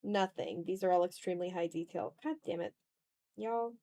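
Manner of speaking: ordinary talk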